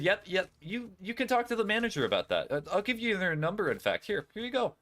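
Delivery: high, sweet service voice; customer service voice. Shown as a demonstration. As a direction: play the overly friendly cashier. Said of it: "I would say it in my customer service voice".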